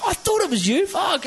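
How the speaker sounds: high-pitched mock fan excitement; excited recognition. Retelling an excited fan's recognition.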